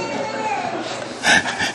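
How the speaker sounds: a high airy chuckle; real amused laugh; high breathy laugh. Real, not acted. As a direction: laugh lightly at the joke. Laughing at own joke.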